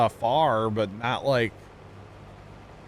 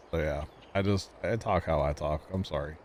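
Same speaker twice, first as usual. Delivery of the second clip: normal talking voice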